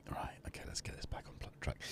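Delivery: whispered note to self; hushed whisper getting back on track. Real, not acted. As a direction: whisper it to yourself. Muttering to himself, back on track.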